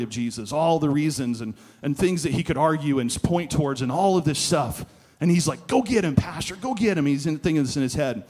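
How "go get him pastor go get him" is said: high, loud mock cheer; urging on. Young pastor cheering himself on in his head, urging. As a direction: egg him on like a fan on the sidelines. Max's imagined inner cheer, acted out.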